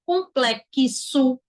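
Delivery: COMPLEXO very slow, broken as "com-plek-ki-so"; sounding out both phonemes. Shown as a demonstration. Teacher showing the X has two phonemes, sounded out slowly. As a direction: stretch COMPLEXO, the X split into K and S. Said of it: "Tem dois fonemas".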